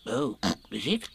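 small, hesitant creature voice; a hint of a timid piglet. A narrator's reading. Piglet meeting Tigger and edging away, small and hesitant. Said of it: "said Piglet, and he edged round".

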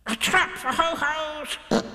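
high, slow husky Piglet; little creature's strained answer. Acted. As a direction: force it out huskily in a small high voice. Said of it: "said Piglet huskily".

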